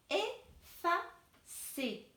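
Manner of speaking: Effacer stretched very slowly; very slow teacher split of Effacer; very slow syllables. Shown as a demonstration. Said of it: "couper le mot".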